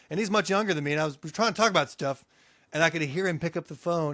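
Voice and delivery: ordinary delivery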